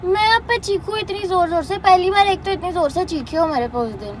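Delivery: loud, hurt accusation; angry complaint. Real, not acted. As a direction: throw his shouting back at him, loud. Angry girlfriend in quarrel. (गुस्से में ऊँची आवाज़ में शिकायत)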